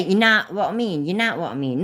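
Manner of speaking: broad Leicester you know what I mean, know said as nah; Leicester accent. Shown as a demonstration. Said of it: "we say".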